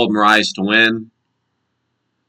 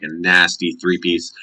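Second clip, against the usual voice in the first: normal talking voice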